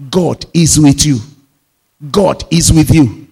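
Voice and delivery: ringing pulpit declaration; loud proclamation. Shown as a demonstration. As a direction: proclaim it to the whole church. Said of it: "repeated three times with growing force".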